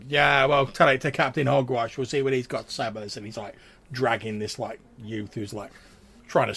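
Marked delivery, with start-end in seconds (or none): 0.1-3.2 loud rough guard voice; gruff guard. Acted. Guard dismisses the youth, loud gruff voice. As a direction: brush him off, louder.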